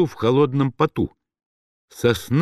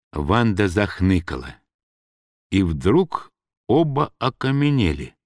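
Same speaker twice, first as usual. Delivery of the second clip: ordinary delivery, nothing marked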